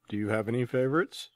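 ordinary talk, nothing marked

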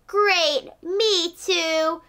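ordinary delivery, nothing marked

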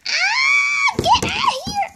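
high shrill shout to chase away. Pretend. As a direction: yell at them to get out. Toy character chases someone away.

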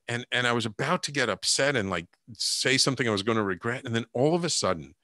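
ordinary talk

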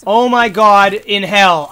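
slow, loud despairing groan; slow loud exasperated moan; drawn-out exasperation. Real, not acted. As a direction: stretch it out in disbelief. Can't use appliances.

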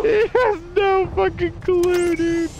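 high, squeaky words through laughter; laughing hard. Real, not acted. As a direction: squeal it out while cracking up. Still cracking up.

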